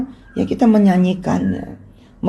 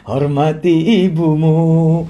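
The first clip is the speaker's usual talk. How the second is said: slow crooner delivery of the line; sing-speak it like the dangdut star; slower than usual. Retold or imitated. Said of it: "suaranya jadi mirip".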